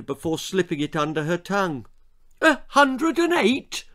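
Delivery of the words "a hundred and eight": high, slow shocked shriek; mother shrieks at the thermometer; mother's shriek. A narrator's reading. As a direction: shriek the number in alarm. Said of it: "her mother would shriek".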